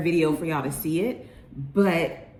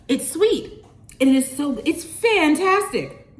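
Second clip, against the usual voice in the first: excited praise; 7 semitones higher, 4 dB louder than usual. Real, not acted. Raving about food.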